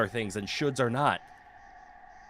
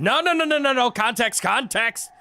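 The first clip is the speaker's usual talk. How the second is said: high, loud, rapid-fire objection; loud high-pitched panic of protest; frantic protest; 12 semitones higher, 5 dB louder than usual. Real, not acted. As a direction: cut in urgently, voice jumping way up. Frantic protest at chat's reply.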